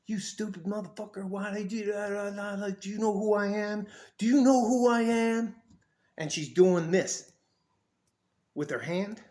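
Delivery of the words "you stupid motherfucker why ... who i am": mock cussing out. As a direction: imitate someone cussing you out. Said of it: "she just starts cussing me out".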